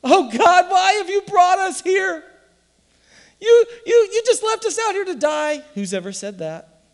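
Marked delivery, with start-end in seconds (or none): 0.0-5.6 much higher, loud wailing lament; crying complaint. Retold or imitated. Israelites crying out to God at the sea, high and wailing. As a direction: wail at God like you're abandoned. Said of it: "they begin to cry".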